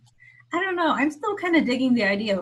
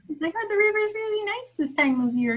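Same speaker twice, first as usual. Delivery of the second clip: raised, sing-song Midwestern voice; Midwestern accent; 9 semitones higher than usual. Pretend. Joining the Fargo joke in a Midwestern accent. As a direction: play it up in a light Midwestern accent. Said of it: "the accent".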